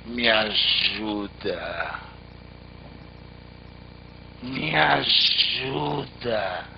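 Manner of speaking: low, very slow hoarse groan; a dying tree calling out, dragged and low. A narrator's reading. Dry tree begs the traveller, low hoarse and slow. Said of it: "uma voz rouca que dizia assim".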